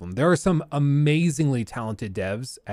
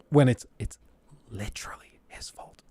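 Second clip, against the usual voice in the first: hushed, voiceless whisper; whispered aside; 4 semitones lower, 6 dB quieter than usual. Real, not acted. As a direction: drop to a whisper in disbelief. Exasperated aside blaming the boss.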